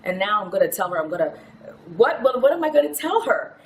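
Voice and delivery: mock desperation, tumbling into stammered repeats; retold tearful panic. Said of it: "Why are you crying?".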